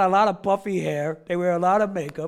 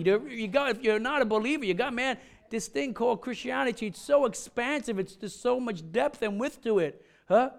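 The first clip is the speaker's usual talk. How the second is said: normal talking voice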